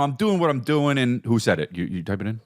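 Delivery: ordinary talk, nothing marked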